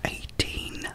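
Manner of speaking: normal talking voice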